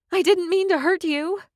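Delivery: high, pleading apology in alarm; distressed plea. Acted. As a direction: beg him to believe it. Rushing to the wounded Rufus.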